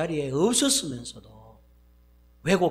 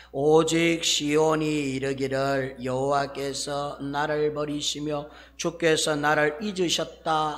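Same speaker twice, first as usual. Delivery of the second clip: slow, loud unison scripture reading; congregation reading; 4 dB louder, slower than usual. Shown as a demonstration. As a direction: read it out together, slowly. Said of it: "시작". (천천히 함께 봉독하듯)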